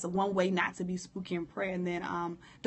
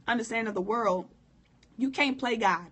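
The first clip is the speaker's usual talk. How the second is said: normal talking voice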